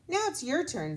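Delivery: Kelly encouraging her friend; friendly girl. Acted. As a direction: invite him to try. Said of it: "said Kelly".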